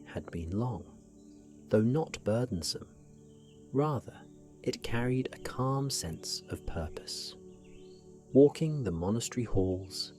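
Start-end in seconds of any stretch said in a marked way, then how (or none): none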